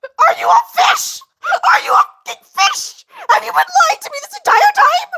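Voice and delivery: mock sobbing outrage at a fish; hammed-up betrayed wail. Acting betrayed at fish reveal.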